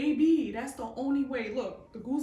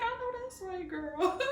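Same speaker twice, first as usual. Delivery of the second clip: high, soft sassy agreement; agreeing; 6 semitones higher, 4 dB quieter than usual. Retold or imitated. Agreeing with the Holy Spirit, high and soft. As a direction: go along with a grin. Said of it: "I be like".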